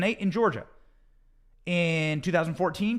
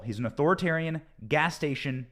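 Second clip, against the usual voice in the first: normal talking voice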